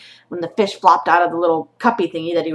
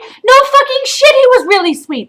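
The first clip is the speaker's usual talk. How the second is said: much higher and louder, spitting it back in fury; furious shout; 7 dB louder than usual. Real, not acted. Mother yells the shelter's words back at them, furious. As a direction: throw their own words back at them, yelling.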